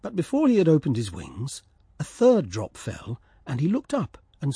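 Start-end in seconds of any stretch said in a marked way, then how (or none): none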